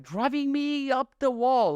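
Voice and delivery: high, slow, fed-up whine; high, slow exasperated complaint. Real, not acted. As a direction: vent the frustration, drawn out. Ranting about lazy cashiers.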